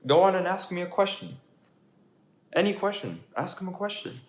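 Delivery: a pigeon who doesn't want to go; whiny pigeon. Acted.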